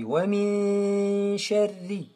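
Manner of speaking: "min" stretched in a steady nasal hum; slow "Wa min sharri", sliding into the sh; nasal ghunna. Shown as a demonstration. Teacher says look, long nasal "min". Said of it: "on le prononce avec une runna".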